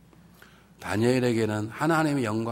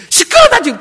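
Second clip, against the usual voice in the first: high, shouted mock anger; angry outburst; 18 dB louder than usual. Dying sister refuses church members' prayers, acted angry shout. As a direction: snap loudly at those praying for you. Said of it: "기도 막 화를 내는 거예요".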